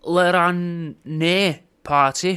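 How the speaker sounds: slow mock-French "Le Rannais" with French vowels. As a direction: stretch out "Le Rannais" very slowly like a hammed-up French name. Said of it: "one of them French-themed ones".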